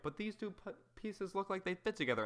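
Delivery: a squeaky, wide-eyed know-nothing; naive mock voice. Mocking someone's naive suggestion.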